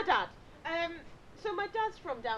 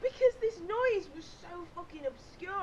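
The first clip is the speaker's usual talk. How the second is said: exasperated; 8 semitones higher, 5 dB louder than usual. Retold or imitated. Ranting about the noise.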